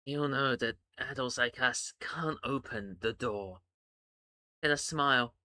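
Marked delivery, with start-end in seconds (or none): 0.1-3.6 low, heavy, soft voice; slow and heavy. Acted. Bucks explaining to Iggy, low and heavy. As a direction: speak low and weary. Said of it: "Bucks says slowly, heavily".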